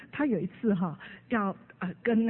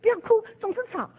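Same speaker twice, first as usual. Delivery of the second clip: scolding parent; 11 semitones higher, 4 dB louder, much faster than usual. Retold or imitated. Parent scolding one-year-old.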